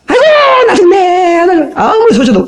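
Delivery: put-on high, slow, crying lament of an old mother; mock wailing. Mother-in-law wailing so her son in the next room hears. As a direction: wail it like a mother-in-law putting on tears.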